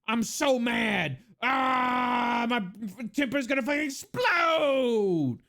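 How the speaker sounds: hammed-up fury building to a drawn-out yell; exaggerated slow-building mock meltdown; mock rage. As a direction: stretch it out and blow up like an overacted tantrum. Mocks viewers enraged by rage bait.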